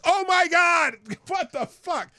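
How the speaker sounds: high shocked yelling, breaking into a laugh; shocked scream turning to laughter; retold horrified shout. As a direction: replay the panic at full volume, then laugh. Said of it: "that's how I was".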